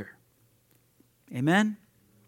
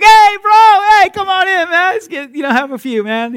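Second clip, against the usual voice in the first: much higher, loud, far-off calling voice; drinking buddy; 14 dB louder than usual. Retold or imitated. Bar buddy shouting to the saved man, high and loud. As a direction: call him into the bar like a rowdy pal. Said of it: "his buddy's like".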